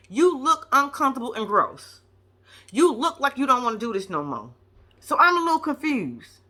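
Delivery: ordinary talk, nothing marked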